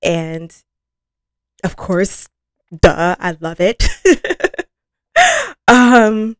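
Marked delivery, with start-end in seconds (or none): 3.9-4.6 high giggle. Real, not acted. Laughing after duh.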